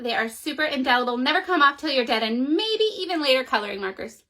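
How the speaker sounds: put-on breathless sales pitch from a kid; fast pleading kid. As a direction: rattle it off quickly. Child pleading, rattling off list.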